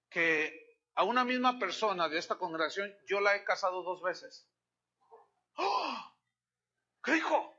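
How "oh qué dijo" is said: a much higher mock "oh" of shock; shocked gasp. Pastor voicing a scandalized congregant, mock-shocked.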